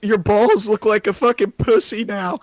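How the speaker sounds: very high, loud squeaky fan voice. Pretend.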